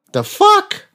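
high, squeaky disbelieving "the fuck?"; incredulous. Acted. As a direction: jump way up in disbelief. Incredulous aside in intro.

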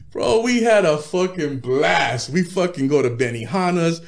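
normal talking voice

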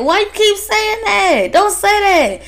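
nervous high-pitched worker begging them to stop; mock-panicked plea, voice way up; high frightened protest. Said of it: "it's kinda like".

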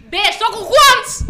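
loud, high, drawn-out gloating; triumphant excitement. Real, not acted. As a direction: call out gleefully for your score. Triumphant after winning a point.

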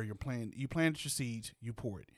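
usual speaking voice

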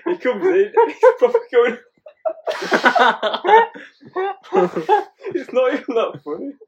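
high-pitched, talking through fits of laughter; real helpless laughing. Real, not acted. Trying to retell dad's fuming entrance, cracking up. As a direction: keep breaking up as you try to tell it.

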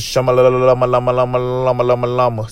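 rapid nonsense babble like a man in a trance; speaking in tongues. Pretend. Podcaster mocking his own trance, babbling in tongues. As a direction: babble the syllables in a fast spiritual gush. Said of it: "It's probably speaking in tongues".

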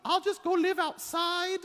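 put-on high, loud sobbing child voice; child crying she is unloved; weeping little girl. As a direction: wail it like a heartbroken five-year-old. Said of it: "was weeping".

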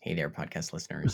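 low-key radio host greeting; Ira Glass. Pretend. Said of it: "'cause you wanna be Ira Glass".

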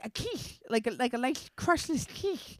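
high, slurred toothless impression; toothless mom. Daughter imitating her toothless mother suggesting a quiche, slurred. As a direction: mush the S sounds as if the teeth are out. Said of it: "without her teeth in".

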